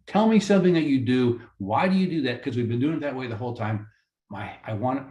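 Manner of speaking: ordinary delivery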